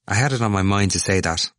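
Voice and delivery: plain reading voice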